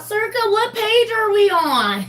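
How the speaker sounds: high, slow mock questioning voice; high-pitched put-on whine of a question; imitating clueless latecomer. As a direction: mimic a pestering viewer, pitched high and drawn out. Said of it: "I know somebody else is gonna come in here".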